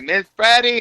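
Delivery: high sing-song call across the block; boys calling out. Retold or imitated. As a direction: call his name out high and teasing. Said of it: "The boys on the block would be like".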